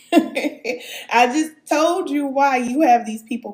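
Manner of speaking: half-laughing as you explain again; amused exasperation, laughing it out. Real, not acted. Laughing exasperated reply to viewer.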